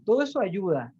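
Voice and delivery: ordinary talk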